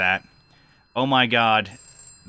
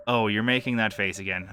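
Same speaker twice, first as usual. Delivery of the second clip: ordinary delivery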